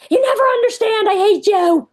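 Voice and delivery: hammed-up tantrum yell at mom; imitating a screaming child. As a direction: shriek it like a furious ten-year-old. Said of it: "Ten-year-old screams".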